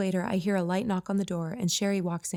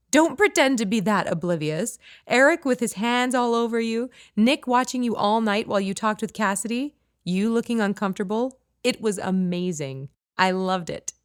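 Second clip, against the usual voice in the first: louder, brighter teasing friend voice; 4 semitones higher, 8 dB louder than usual. Acted. Friend Sherry gleefully teasing Kate about the party, louder. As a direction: gleefully rub it in.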